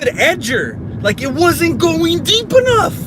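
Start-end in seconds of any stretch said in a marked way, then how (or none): 1.2-3.0 high-pitched exasperated complaint. Real, not acted. Ranting about the stupid edger, exasperated. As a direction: push the pitch up in frustration.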